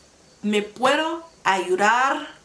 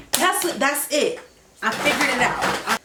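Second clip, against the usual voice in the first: excited; 6 semitones higher than usual. Real, not acted. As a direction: celebrate finally cracking it. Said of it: "I'm getting so excited now".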